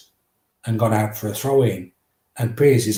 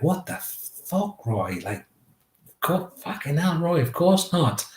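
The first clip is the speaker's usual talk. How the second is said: higher, slow incredulous cry of the lads; mock disbelief; 6 semitones higher, 4 dB quieter, slower than usual. Teammates react to Roy's odd question, higher mock disbelief. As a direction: act out shocked teammates, pitched up.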